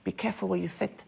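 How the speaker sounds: lisping posh boxer voice; Chris Eubank lisp. Retold or imitated. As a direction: lisp the s as f, softly. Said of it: "I can do a very good Chris".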